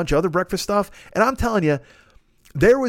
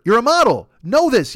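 ordinary talk

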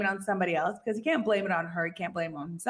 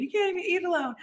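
mocking Ross; 9 semitones higher, much faster than usual. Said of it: "kinda saying".

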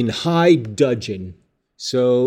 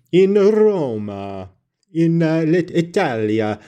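mock Italian accent; 6 semitones higher, slower than usual. Mock Italian pronouncing Roma, Italia.

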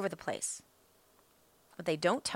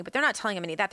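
usual speaking voice

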